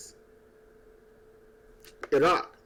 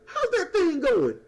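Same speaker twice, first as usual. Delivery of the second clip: very high, laughing oh-that's-right; hammed-up airy surprise; mock remembering; slower than usual. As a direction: play a forgetful voter suddenly recalling. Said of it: "Somebody like".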